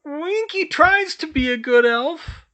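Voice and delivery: very high, loud, slow tearful plea; begging to be good; crying elf. A narrator's reading. Winky crying.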